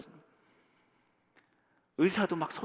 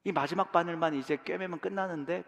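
normal talking voice

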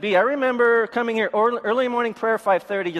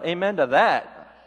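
normal talking voice

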